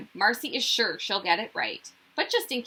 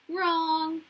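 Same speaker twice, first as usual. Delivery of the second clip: a grinning, higher "wrong"; teasing with a grin; 7 semitones higher than usual. Acted. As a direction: correct him with a smug grin. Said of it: "Lucy says with a grin".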